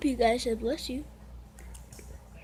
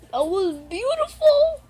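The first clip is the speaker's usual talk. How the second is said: louder, higher, stretched out beautiful; overwhelmed gush; 10 semitones higher, 7 dB louder than usual. Real, not acted. Reactor to poem video, overwhelmed gushing praise.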